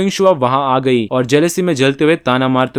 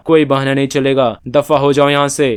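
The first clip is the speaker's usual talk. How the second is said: plain reading voice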